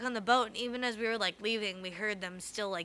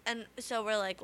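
normal talking voice

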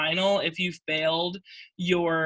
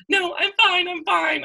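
mock tearful insistence; 12 semitones higher, 4 dB louder, slower than usual. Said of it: "I was, like, bawling my eyes out".

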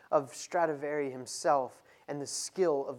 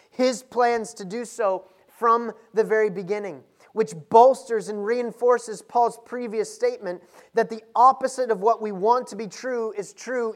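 louder, higher, driving sermon climax; preaching at full force; 6 semitones higher, 10 dB louder than usual. Acted. Pastor urging his church on Paul's emphasis, fervent preaching. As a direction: press the point home hard, rising with each phrase.

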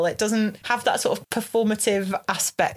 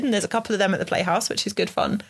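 ordinary delivery

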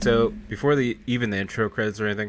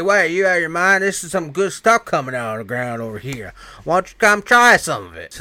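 mock-indignant, raised voice; imitated loud retort; 6 semitones higher, 7 dB louder than usual. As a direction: argue back loudly as the retold character. Retold man argues back loudly.

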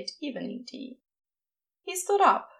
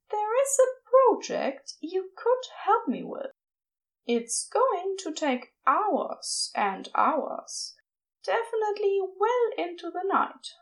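higher, playful coy voice; coy Aziraphale; 8 semitones higher than usual. A narrator's reading. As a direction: tease him with the offer. Aziraphale teasing suggestively.

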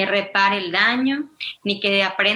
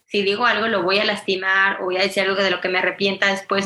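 ordinary delivery

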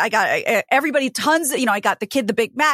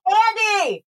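high call across the room to her husband; 9 semitones higher than usual. Real, not acted. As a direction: call out to Andy. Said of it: "I was gonna yell at my husband".

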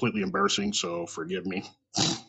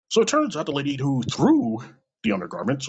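usual speaking voice

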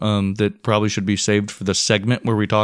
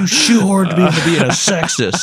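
high, raised-voice accusation; hammed-up indignant wail; mock outrage; 8 semitones higher, 4 dB louder than usual. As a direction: protest loudly in jest. Mock accusation thrown back, laughter.

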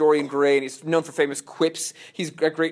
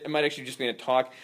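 normal talking voice

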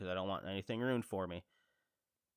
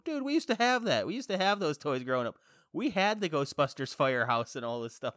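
higher, louder gushing; excited; 6 semitones higher, 7 dB louder than usual. Retold or imitated. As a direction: relive the childhood thrill. Excited reply to friend.

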